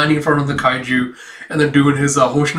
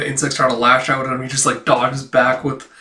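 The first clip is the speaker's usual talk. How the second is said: normal talking voice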